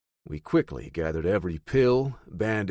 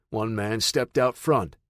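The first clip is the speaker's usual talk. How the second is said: ordinary delivery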